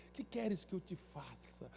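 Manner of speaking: Jesus gently asking the blind man what he wants; soft and gentle. Acted. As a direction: ask him kindly, almost hushed. Repeated for emphasis.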